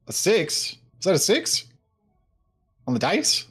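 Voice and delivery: high, loud incredulous questions; loud disbelief. Real, not acted. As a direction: demand an answer, astonished at the roll. Disbelief at a bad dice roll.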